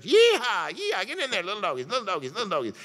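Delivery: high, loud cowboy holler; hammed-up cowboy call herding cattle; cowboy yeehaw. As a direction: whoop like a cowboy driving cattle. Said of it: "herded them into the church".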